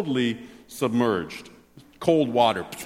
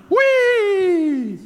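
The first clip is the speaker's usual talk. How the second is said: high loud gleeful whee; spinning whee; 5 dB louder than usual. Pretend. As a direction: let out a joyous squeal as you spin. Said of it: "spinning around".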